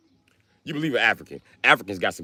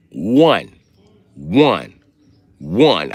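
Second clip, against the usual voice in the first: One punched loud each time; stress on One; 5 dB louder, much slower than usual. Real, not acted. Insisting to chat he sent one request, loud stressed One. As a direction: hammer One three times. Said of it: "I sent her one".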